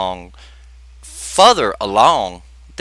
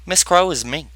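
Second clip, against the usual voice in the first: normal talking voice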